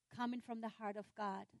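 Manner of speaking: ordinary talk, nothing marked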